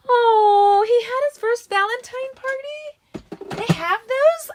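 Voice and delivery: high, delighted slow "oh" of surprise; surprised. Real, not acted. Crafter reacting to a viewer's comment, surprised and delighted. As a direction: marvel at the news in a high voice.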